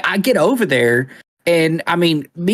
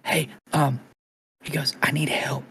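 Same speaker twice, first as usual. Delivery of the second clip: hushed, much softer caller voice; imitated whispering; 3 semitones lower, 9 dB quieter than usual. Husband secretly calling nine one one for help, imitated whisper. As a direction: keep it low, as if secretly phoning. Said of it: "And he's whispering".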